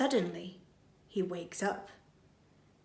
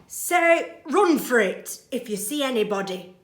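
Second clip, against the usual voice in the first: coaching burglar; 3 semitones higher, 7 dB louder than usual. Acted. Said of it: "says Burglar Bill".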